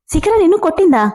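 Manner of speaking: can't believe she was hit; surprised. Acted. Shocked at news of slap. (ఆశ్చర్యంగా)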